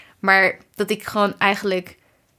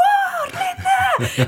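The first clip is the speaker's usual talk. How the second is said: high shriek of her name, much higher; fan scream. Retold or imitated. Fan screaming as Linde is announced. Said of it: "gewoon zo keihard van".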